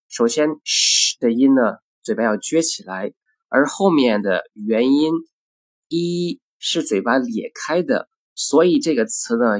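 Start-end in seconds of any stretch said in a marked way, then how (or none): none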